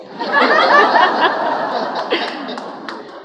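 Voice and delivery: high, loud laugh; big laugh. Real, not acted. Honoree laughs out loud, teasing her bosses. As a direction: burst out laughing.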